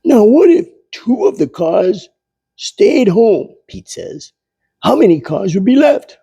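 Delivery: put-on high, quick quizzing child voice; high fast schoolboy posing a word problem; eager kid Pete. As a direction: rattle off the puzzle like a keen kid.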